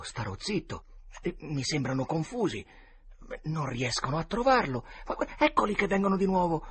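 put-on high, tense boy's voice, frightened; high frightened kid voice, on the edge of tears; scared boys. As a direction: play a terrified boy hiding among graves. Boys and grave-robbers' voices.

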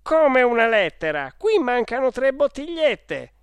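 high, very loud indignation; furious shout. Acted. Salesman counting missing ink bottles, shouting furiously. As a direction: explode at the boy's excuse.

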